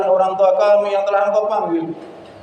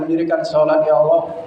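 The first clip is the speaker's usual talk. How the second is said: ordinary talk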